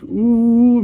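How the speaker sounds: high, loud, drawn-out "oooh"; teasing "ooh". Retold or imitated. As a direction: imitate a buddy egging someone on. Buddy egging the teacher on.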